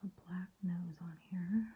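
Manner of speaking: soft whisper, fully concentrating. Real, not acted. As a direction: barely breathe it while painting the eyes. Concentrating on painting eyes.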